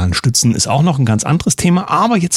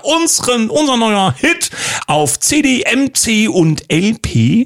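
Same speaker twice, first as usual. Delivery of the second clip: radio ad announcer; 11 semitones higher, slower than usual. Pretend. Mock radio ad for records.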